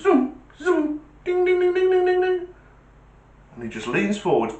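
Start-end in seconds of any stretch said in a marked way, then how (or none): 0.0-2.5 high sing-song zoom and ding noises; mock zoom and bell. Copper mimicking the cars and the bell, high sing-song. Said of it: "he just goes".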